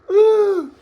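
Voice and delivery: loud, high-pitched whoop. Real, not acted. Host whoops after ribbing his guest, loud and high. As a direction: let out a loud high "woo".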